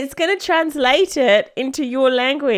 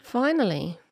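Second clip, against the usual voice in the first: softer, unimpressed remark; 6 dB quieter than usual. Real, not acted. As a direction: say it softly, as if unimpressed. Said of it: "[name]'s not impressed".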